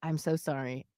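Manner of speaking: low, soft and halting; calm apology. Retold or imitated. Mom apologising to her daughter, calm. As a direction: re-enact a measured, sincere apology with pauses. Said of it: "I calmly, I was like".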